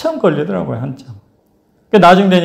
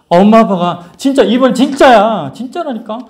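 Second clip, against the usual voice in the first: imitating urgent coaxing, pitched up; insistent persuasion; 7 semitones higher than usual. Policewoman persuading the children to come, insistently. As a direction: press them to believe you.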